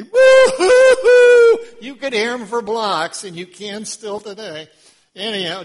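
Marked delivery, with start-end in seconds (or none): 0.0-1.6 loud, high, drawn-out mock wailing; mourners' wail. Preacher mimicking loud mourners, high hammed-up wail. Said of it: "They mourn loudly".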